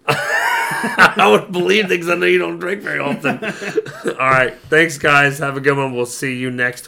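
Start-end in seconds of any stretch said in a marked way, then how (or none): 0.0-4.1 laughing out loud. Real, not acted. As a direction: talk through a big laugh. Laughs at friend claiming drunk.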